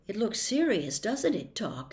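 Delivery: ordinary delivery, nothing marked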